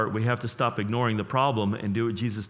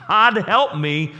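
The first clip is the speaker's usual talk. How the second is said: desperate prayer; 11 semitones higher, 10 dB louder, slower than usual. Acted. Crying out in prayer.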